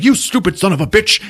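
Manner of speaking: high-pitched mock cursing; angry character voice. Magua curses failed grenade throw.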